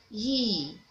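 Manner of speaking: ordinary talk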